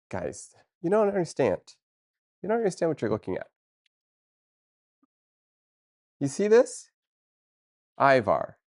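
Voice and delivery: high, incredulous plea; can't believe they don't get it; exasperated disbelief. Real, not acted. Streamer pleading with chat about Ivar, exasperated.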